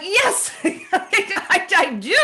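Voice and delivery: high, loud yes with a laugh breaking in; eager yes. Retold or imitated. Telling the host how she answered the voice, excited and laughing. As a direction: answer the call gladly, laughing. Own eager reply, recalled.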